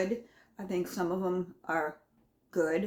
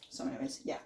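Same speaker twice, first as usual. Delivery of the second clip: ordinary talk, nothing marked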